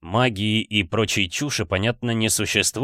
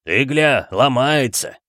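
second thug; 3 semitones higher, 4 dB louder, slower than usual. A narrator's reading. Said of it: "присоединился второй голос".

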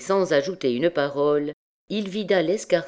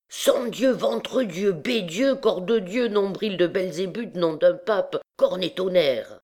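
a booming, higher voice hurling oaths; loud swearing; 5 semitones higher than usual. A narrator's reading. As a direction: roar out a string of curses. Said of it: "une voix forte et sonore articuler une série formidable de jurons".

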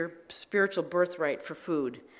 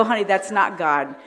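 laughing; 3 semitones higher, 11 dB louder than usual. Retold or imitated. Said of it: "Lloyd would go".

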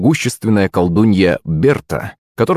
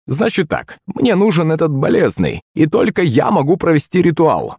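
high crone voice, insistent; businesslike old witch; 8 semitones higher than usual. A narrator's reading. Witch demanding the patient for a ritual, insistent. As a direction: lay down terms like an old witch.

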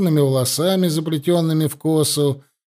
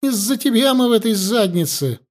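higher, blaming shout; angry; 8 semitones higher than usual. A narrator's reading. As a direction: hurl the blame at him. Viola blames husband angrily.

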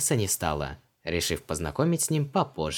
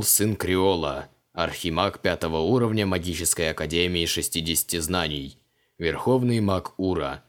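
Creole's grand titles; 3 semitones lower than usual. A narrator's reading. Creole proudly recites his titles to Vanessa, solemn. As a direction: declaim every title with pomp.

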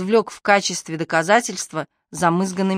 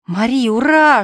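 whispered shout; 5 semitones higher, 6 dB louder than usual. A narrator's reading. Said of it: "шёпотом закричал мальчик".

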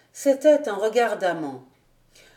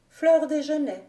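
Alix's higher-pitched echo of the name; lightly voiced female character, pitched up; repeating; 6 semitones higher than usual. A narrator's reading. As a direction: say it back higher, as the young woman. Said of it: "répéta-t-elle".

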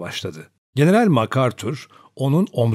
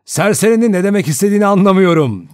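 fast, loud, higher-pitched complaint; angry grumbling; 8 semitones higher, 6 dB louder, faster than usual. A narrator's reading. Anthony Marston objecting to the accusation, grumbling loudly. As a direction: protest irritably in a rush. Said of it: "diye homurdandı Anthony Marston".

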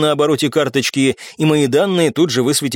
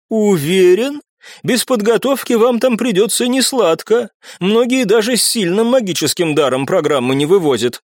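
doubtful, higher, raised-brow tone; skeptical; 7 semitones higher than usual. A narrator's reading. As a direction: question his confidence with doubt. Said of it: "со скепсисом в голосе произнесла". (скептически)

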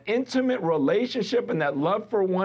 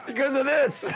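laughing; 10 semitones higher than usual. Real, not acted. Laughing about Liz quitting.

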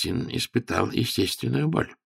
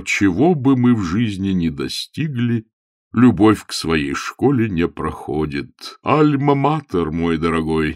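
put-on sentimental old cleric reminiscing; emphatic, wistful sermonizing tone; nostalgic bishop; 4 semitones higher, 7 dB louder than usual. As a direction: wax wistful, lingering on phrases.

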